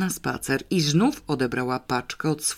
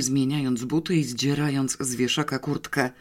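usual speaking voice

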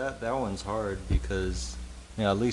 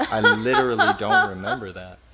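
laughing protest; 2 semitones higher, 10 dB louder, faster than usual. Retold or imitated. Retelling forgotten outburst, laughing.

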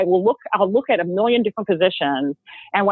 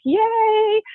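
excited yay. Retold or imitated. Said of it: "I was like".

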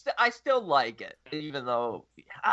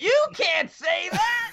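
high, slow shrieking protest; shrieking outrage; 13 semitones higher, 5 dB louder, much slower than usual. Acted. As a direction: shriek it in mock horror. Mock outrage at midget remark.

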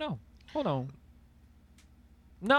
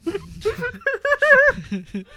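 words broken by laughter; laughing; 11 semitones higher, 6 dB louder than usual. Real, not acted. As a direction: laugh through it.

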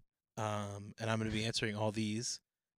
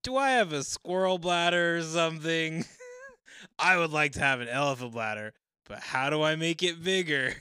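dramatic reading of own post; 5 semitones higher, 7 dB louder than usual. Acted. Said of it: "my description that I wrote".

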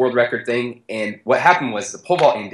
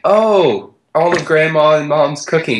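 delighted about food; 5 semitones higher, 3 dB louder, slower than usual. Retold or imitated. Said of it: "it's like".

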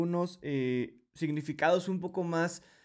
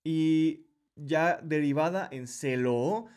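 usual speaking voice

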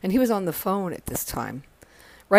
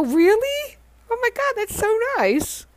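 much higher delighted squeal; thrilled surprise; 4 dB louder than usual. Retold or imitated. Retelling her reaction to the gift, much higher and delighted. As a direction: gasp it out, overjoyed at the gift.

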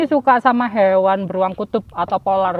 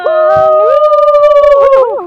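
high, loud whoop; excited whoop; 9 dB louder than usual. Acted. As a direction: let out a happy cheer. Cheer after the intro.

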